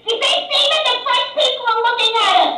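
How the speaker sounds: very high, loud Long Island screech; Long Island accent. Acted. Wife telling Steven the French are staring, Long Island screech. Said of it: "they were talking like this".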